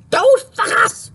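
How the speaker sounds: high, slow, shrieking furious impression; pissed-off Claudia. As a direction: shriek it like an enraged friend. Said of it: "Claudia, when she's pissed off".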